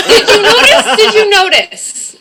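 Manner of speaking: high, loud, insistent challenge; emphatic comeback. Real, not acted. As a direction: pounce on it loudly, drawing out the repeat. Defensive comeback to a teasing remark.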